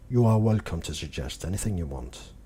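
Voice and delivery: ordinary talk, nothing marked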